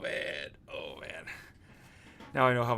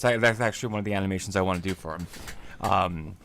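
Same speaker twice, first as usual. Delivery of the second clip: normal talking voice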